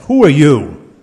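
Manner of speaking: angry gopi's demand. Acted. Angry gopi confronts Krishna, loud, sharp demand. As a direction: fling the question at him. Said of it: "the gopi asks".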